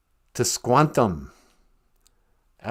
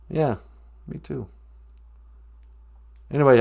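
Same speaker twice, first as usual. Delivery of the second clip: ordinary delivery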